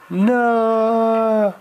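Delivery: high, loud anguished cry; wailing no. Acted. Teacher on his knees wails over his destroyed car. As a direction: howl it over the wreck. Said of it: "weeping for his car".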